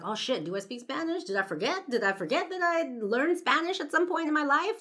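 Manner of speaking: suddenly doubt yourself, half joking; mock self-doubt. Girl briefly doubting herself after the stranger insists, mock panic.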